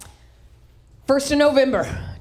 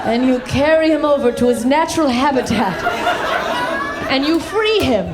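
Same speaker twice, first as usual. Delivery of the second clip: mock tender rescue; 4 semitones higher than usual. Explaining to LA people, mock-sweet nature lover. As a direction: coo it like a naive nature lover. Mocking gentle LA snake rescue.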